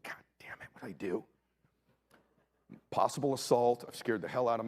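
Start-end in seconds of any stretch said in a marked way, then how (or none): 0.0-1.2 hushed, voiceless panic to himself; panicked whisper. Retold or imitated. Father whispering in panic after scaring his son. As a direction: whisper it in sudden dread.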